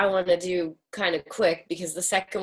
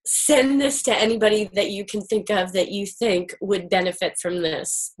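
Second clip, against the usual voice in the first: ordinary delivery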